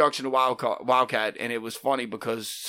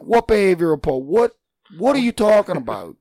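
shocked disbelief; 8 semitones higher, 8 dB louder than usual. Retold or imitated. Dad shocked at teacher's call.

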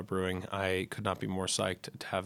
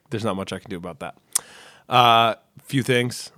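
ordinary talk

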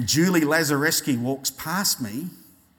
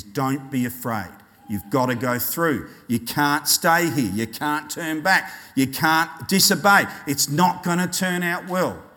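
stern. Acted. God urging his people onward, stern. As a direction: press each phrase home. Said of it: "but sometimes he speaks sternly".

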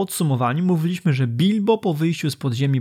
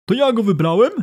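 mild angry Gandalf again; repeating the wizard's line; reread quote; 6 semitones higher, 3 dB louder than usual. Retold or imitated. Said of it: "Powtórzę najpierw czytany fragment".

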